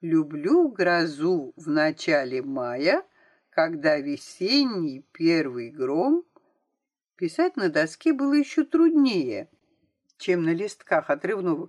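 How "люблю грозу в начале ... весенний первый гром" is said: slow teacher dictating a line; slow dictation. Acted. Teacher dictates verse to pupil at blackboard, slow dictation. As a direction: dictate it word by word, drawn out.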